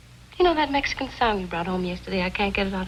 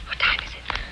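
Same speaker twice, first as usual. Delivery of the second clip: toneless whispered question; hushed bedtime whisper. Acted. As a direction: whisper it in the dark. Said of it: "You don't have to whisper".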